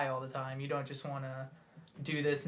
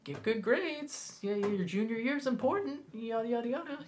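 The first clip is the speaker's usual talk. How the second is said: nagging adult; 9 semitones higher than usual. Retold or imitated. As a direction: lecture like a preachy grown-up. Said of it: "adults who were like".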